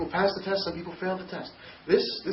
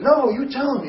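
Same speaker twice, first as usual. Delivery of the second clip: higher and louder, a hard no; retold yell; 7 semitones higher, 7 dB louder than usual. Brother insisting he tell the grandparents, retold loud yell. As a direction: bark the order back at him. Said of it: "He's yelling at me".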